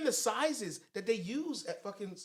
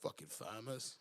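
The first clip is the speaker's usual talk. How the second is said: low, hushed mutter; softly grumbled mock insult; retold muttered insult; 10 semitones lower, 13 dB quieter than usual. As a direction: mutter it under your breath, imitating him. Retold man mutters, head down.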